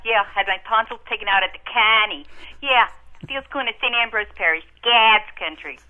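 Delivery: loud, high Boston neighbourhood brag; broad Dorchester accent. Pretend. Caller lists her Dorchester landmarks to host, broad Boston accent. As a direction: play up the Dorchester Boston accent, punched out loudly.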